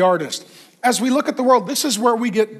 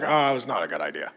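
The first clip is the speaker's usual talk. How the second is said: much lower, softer and quick, like a sheepish admission; regretful aside; 11 semitones lower, 7 dB quieter, faster than usual. Retold or imitated. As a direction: mutter it to yourself after a bad choice. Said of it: "and you're like".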